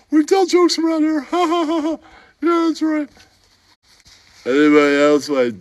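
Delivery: high, squeaky put-on character voice, with giggles; squeaky comic voice. Comic sidekick telling a bar story in a squeaky giggling voice. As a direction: play a giggling oddball sidekick.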